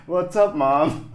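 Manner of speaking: loud, high call of what's up to mom. Retold or imitated. Son answering his mom who called his name, loud and high. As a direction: shout it back across the house, a bit cheeky. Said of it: "and then I would like".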